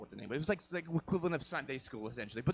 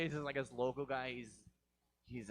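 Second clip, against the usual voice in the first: usual speaking voice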